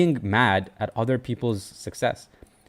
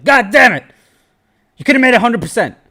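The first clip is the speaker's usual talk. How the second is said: much higher and louder, a furious self-directed yell; mad at himself; 12 semitones higher, 11 dB louder than usual. Retold or imitated. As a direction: replay the moment you kicked yourself for selling too early. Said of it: "get so mad at myself".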